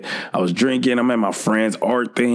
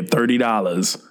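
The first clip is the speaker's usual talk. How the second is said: normal talking voice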